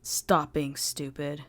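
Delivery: put-on low, slow, heavy Japanese accent; thick Japanese accent. A narrator's reading. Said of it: "said with a very thick Japanese accent".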